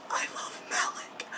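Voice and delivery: soft, voiceless gushing whisper; overwhelmed fan whisper. Real, not acted. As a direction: whisper it, overcome with love. Fan overcome by favourite couple.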